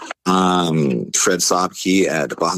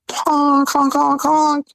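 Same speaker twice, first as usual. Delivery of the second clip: high, held honking noise; imitated car horn; much slower than usual. Driver honking to warn them, imitated car horn. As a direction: mimic a horn, high and insistent.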